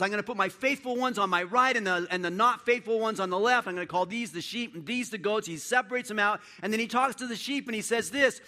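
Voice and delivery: loud, high, driving sermon climax; fiery preacher at full volume; preaching at full force. Acted. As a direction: preach it out, building to a roar.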